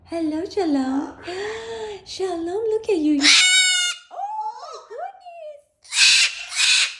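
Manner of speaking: high squealing greeting. Real, not acted. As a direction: gush over the bird. Excited greeting of bird.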